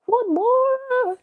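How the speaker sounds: mock pleading squeak. Host playfully begging silent viewers for a reply, high mock plea.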